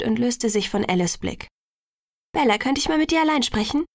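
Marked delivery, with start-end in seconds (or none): 2.3-3.8 quick, higher-pitched asking; quick request. A narrator's reading. Alice asks Bella for a word alone, quickly. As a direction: ask it in a hurry. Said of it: "fragte Alice schnell".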